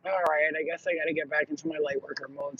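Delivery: low, subdued grumble; resigned self-talk. Retold or imitated. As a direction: give in reluctantly, low and soft. Resigned self-talk after the screaming.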